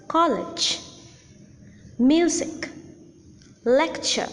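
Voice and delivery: COLlege, MUsic, LECture; first-syllable stress. Shown as a demonstration. Teacher gives noun examples college, Music, Lecture, stressed on the first syllable. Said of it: "the accent will fall on the first syllable".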